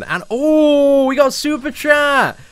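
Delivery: high, loud delighted announcement; excited. Real, not acted. Streamer spotting a super chat, loud and excited. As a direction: burst out with the good news.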